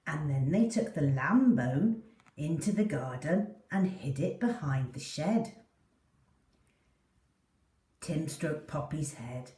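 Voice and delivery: plain reading voice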